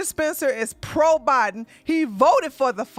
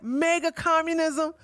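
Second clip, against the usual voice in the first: higher, incredulous question, repeated; mocking disbelief; 7 semitones higher than usual. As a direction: echo the phrase as if it were absurd. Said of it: "That's why I'm like".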